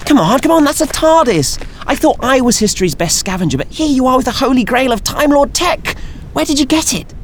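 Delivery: very high, eager nasal voice; pestering with excitement; eager curiosity. Acted. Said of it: "full of curiosity and questions".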